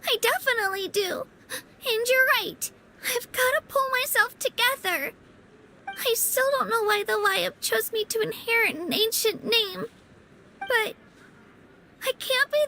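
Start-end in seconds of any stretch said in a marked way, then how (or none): 0.1-6.7 fighting back tears of relief; tearful. Acted. Kachina to her friend, close to crying. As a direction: voice catching with tears, higher.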